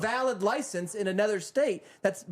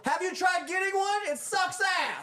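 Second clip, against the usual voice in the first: much higher, outraged pitch with the last words slowed and laughing; exasperated high voice; 13 semitones higher than usual. Retold or imitated. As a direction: shoot it up high in disbelief, drag out the end. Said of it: "I wanna say to those people".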